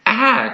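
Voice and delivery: high cry of strain; a cry as the grip slips. A narrator's reading. Carlos losing hold on the tail, high cry. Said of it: "Carlos exclaimed".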